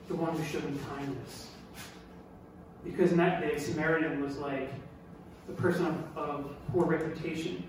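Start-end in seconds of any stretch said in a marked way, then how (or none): 0.1-1.5 much softer, grudging answer; reluctant mutter. Acted. Lawyer avoiding the word Samaritan, grudging and soft. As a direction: mumble it, unwilling to say the name. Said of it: "the guy couldn't say Samaritan".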